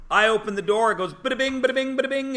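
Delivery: high mock door chime; playful high-pitched bell noise. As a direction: sing out the shop bell like a jingle. Door chime imitated at shop entrance.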